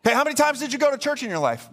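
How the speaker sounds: high-pitched, rapid questioning; interrogating angel. Acted. Angel quizzing the thief on church going, insistent.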